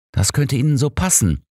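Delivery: a touch of irritation; slightly irritated. A narrator's reading. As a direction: bristle at the suggestion. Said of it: "sagte ich leicht gereizt".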